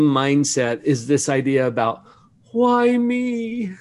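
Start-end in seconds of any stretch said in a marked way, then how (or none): 2.5-3.7 high, whiny self-pity. Retold or imitated. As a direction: whine it like a sulking victim. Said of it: "Kind of in a whiny voice".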